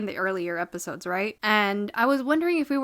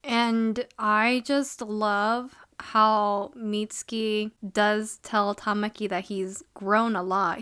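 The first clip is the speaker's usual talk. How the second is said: ordinary talk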